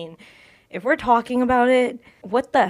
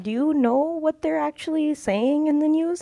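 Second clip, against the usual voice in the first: higher, pointed question to grandfather; exasperated challenge; 7 semitones higher than usual. Retold or imitated. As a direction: press him, incredulous. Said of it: "I'm like".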